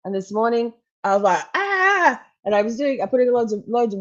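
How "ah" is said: high retold yell of panic; imitated frazzled shriek; mock panicked scream. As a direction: act out a drawn-out, high "Ah!". Retelling her panic that morning.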